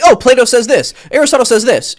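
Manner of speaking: imitating someone reciting borrowed authority; mock philosophy student. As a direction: parrot it like an eager student showing off. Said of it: "people who study philosophy and they're like".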